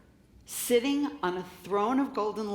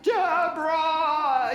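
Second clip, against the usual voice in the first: booming slow call, much louder and higher; Charlton Heston's booming God; 8 semitones higher, 7 dB louder, much slower than usual. Retold or imitated. God calling Deborah from the heavens, booming Charlton Heston style. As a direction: boom her name like a movie God. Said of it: "it's not like Charlton Heston".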